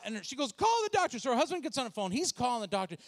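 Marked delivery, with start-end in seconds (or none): 0.5-1.2 high, loud plea for help; acted urgent cry. Woman in labor to her husband, urgent high shout. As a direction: cry out in mock labor distress.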